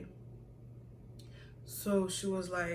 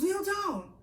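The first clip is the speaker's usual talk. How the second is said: high, sharp order; shouted command; 12 semitones higher than usual. Retold or imitated. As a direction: snap the command across the room. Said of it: "you know when someone shouts".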